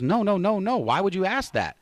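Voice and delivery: lightly voiced indignant woman; imitated woman's protest. Retelling the woman's startled protest.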